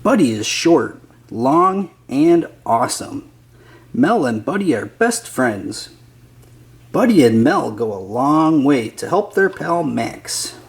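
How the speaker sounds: ordinary delivery, nothing marked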